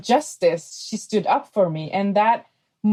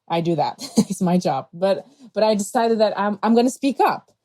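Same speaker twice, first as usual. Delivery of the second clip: ordinary talk, nothing marked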